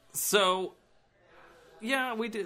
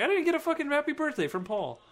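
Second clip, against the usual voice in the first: whiny voice; 12 semitones higher, 3 dB louder than usual. Retold or imitated. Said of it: "that fucking voice made me fucking think of Paul".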